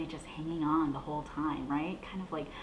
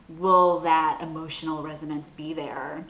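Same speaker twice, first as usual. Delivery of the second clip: normal talking voice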